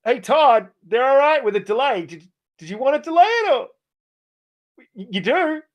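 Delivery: high, put-on Microsoft exec asking Todd; lightly voiced boss, pitched high; mock executive voice. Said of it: "they're like".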